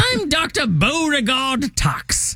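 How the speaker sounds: high, slow hammed-up character voice; put-on doctor character. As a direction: introduce yourself as a high-voiced comic doctor. Answers with own put-on character voice.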